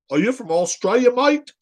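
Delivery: put-on Australian accent with a high rising mate; mock Aussie accent. Host quizzing the caller in a mock Australian accent. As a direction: ask it like a cheeky Aussie.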